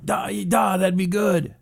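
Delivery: hammed-up Russian accent, Nyet thrown in; bad Putin impression. Host plays Putin answering Trump, mock Russian accent. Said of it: "horrible impression was".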